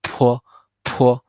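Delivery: Po said with a strong puff of air; aspirated P. Shown as a demonstration. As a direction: breathe hard out on Po. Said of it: "the P with a lot of air".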